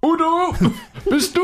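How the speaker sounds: put-on high squeaky old lady calling; high excited old woman greeting; shrill old lady. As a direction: play a delighted old aunt. Comic skit, old ladies greeting.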